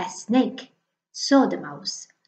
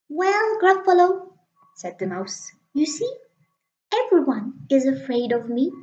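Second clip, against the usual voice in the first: much higher mouse, bright and quick; little mouse voice; 6 semitones higher than usual. Acted. The mouse announcing Snake, high little voice. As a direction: pitch it up like a tiny mouse.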